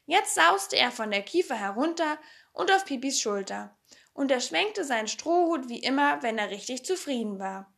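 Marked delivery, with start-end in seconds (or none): none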